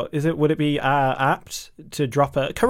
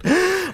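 gasp for air; 4 dB louder than usual. Real, not acted. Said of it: "I'm running out of breath".

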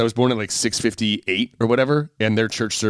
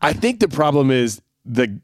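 normal talking voice

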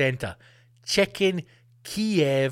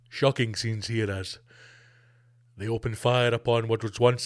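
grave news reporter; 6 semitones lower than usual. Pretend. Mock war news reporter.

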